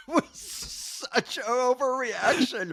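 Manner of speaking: slow, high, drawn out through laughter; real helpless cracking up; laughing in disbelief. Real, not acted. Cracking up at roommate's story.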